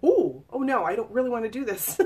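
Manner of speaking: a replayed oh-no moment, half laughing at herself; mock panicked reluctance. Woman replaying her own panic as it began, mock alarmed.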